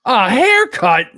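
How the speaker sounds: high, loud, stretched-out Irish exclamation; Irish accent. Acted. Co-host mock-marvels at the haircut prize in an Irish accent. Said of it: "I'm doing an Irish accent".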